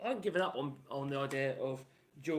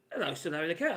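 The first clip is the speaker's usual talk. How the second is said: normal talking voice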